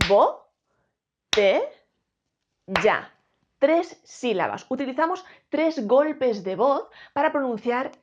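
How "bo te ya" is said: "bo", "te", "ya" punched out loud with pauses; loud, spaced teaching beats; syllable by syllable. Shown as a demonstration. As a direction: clap out each syllable of "botella" loudly. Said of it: "Fijaos, primero decimos".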